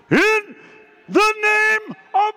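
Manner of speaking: loud, high cry with drawn-out words; commanding prayer shout. Acted. Believer in a crisis calling on Jesus, commanding shout. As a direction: proclaim it at full force, holding each word high. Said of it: "the first word out of your mouth is".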